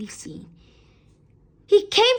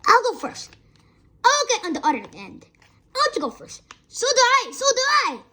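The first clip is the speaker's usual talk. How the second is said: put-on 1 eager children; 11 semitones higher, faster than usual. A narrator's reading. Children clamouring to go first.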